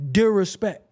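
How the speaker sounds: DISRESPECT slurred to "dir-respect", dropping the S; slang pronunciation. Shown as a demonstration. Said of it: "that's how so many people talk".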